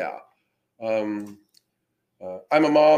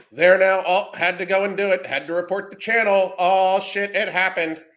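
higher, louder sneering mimic voice; mocking the reporter; 6 semitones higher, 5 dB louder than usual. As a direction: sneer it back like a smug tattletale.

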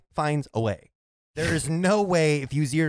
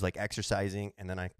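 ordinary talk

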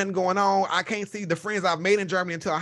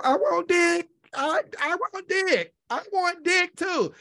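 insistent; 9 semitones higher, slower than usual. Retold or imitated. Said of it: "I'm like".

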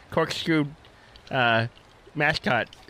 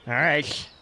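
ordinary delivery

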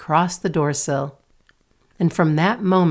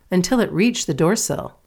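usual speaking voice

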